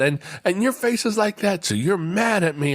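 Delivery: high child voice, lightly imitated; upset young son. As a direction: mimic a small boy making his accusation. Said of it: "he breaks into tears".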